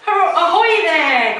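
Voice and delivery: loud, high boat-to-shore call; hearty hailing. Acted. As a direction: hail them cheerfully from the boat. Grandpa Pig hails from boat.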